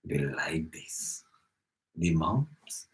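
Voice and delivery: low, slow, whispery come-on; hushed insinuating question; creepy sweet-talker. Acted. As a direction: drop to a slow, husky whisper. Creepy put-on pickup routine.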